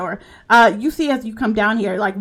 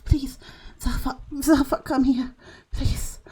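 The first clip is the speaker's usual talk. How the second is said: whispered plea; 5 semitones higher, 4 dB quieter than usual. Acted. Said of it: "you can hear tiny whispers".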